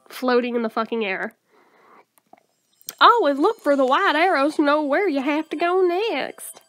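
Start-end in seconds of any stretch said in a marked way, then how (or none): 3.0-6.6 put-on hammy drawling accent with swooping pitch. Game heroine showing her cards and diary, thick drawling accent. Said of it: "I should do it with an accent".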